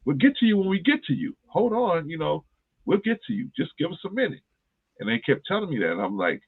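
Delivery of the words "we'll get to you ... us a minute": higher, offhand doctor brushing him off; mock the busy doctor, airy and high; doctor waving off a patient, mock dismissive. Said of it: "And he's like".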